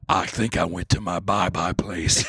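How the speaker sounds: spaced-out veteran. Pretend. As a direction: play a man lost in his head, drifting. Imitating shell-shocked veteran.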